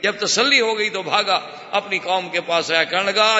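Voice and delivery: ordinary delivery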